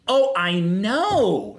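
mock-dainty, drawn-out tooth fairy voice. Big gym guy agreeing in line, in a tooth fairy voice. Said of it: "the tooth fairy ends up being behind me".

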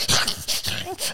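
imitated frantic dog, voiceless rasping barks; raspy snarling dog imitation; crazy dog noises. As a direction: mimic a dog going crazy. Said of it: "making those sounds and everything".

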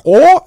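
loud, very high gasp of scandal; hammed-up outcry of shock; mock shocked "oh". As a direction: shout a shrieky, scandalised "oh". Kids react to the forbidden word.

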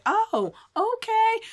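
high, drawn-out mimicked reply; mock-impressed acknowledgement. Lady told to remember the singer's name, mock-impressed. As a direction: imitate her with a slow, high-pitched okay.

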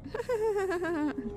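put-on high eerie childlike giggle; rapid high-pitched ghostly giggle, trailing downward; imitated child laugh. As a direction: imitate a creepy little kid's horror laugh. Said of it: "ketawanya kayak".

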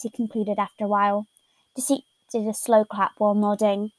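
usual speaking voice

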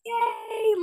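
high, loud reenacted cheer; celebrating squeal. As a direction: cheer as if finally free to celebrate. Replays celebrating once teacher left.

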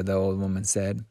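plain reading voice

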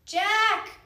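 high, loud call; crying out. A narrator's reading. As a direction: call out for your brother. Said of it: "she cried".

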